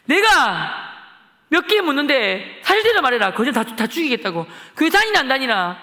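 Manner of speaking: loud, high threatening questions; bully the kids into answering; menacing interrogation. Retold or imitated. Schoolboy grilling classmates about church, loud and threatening.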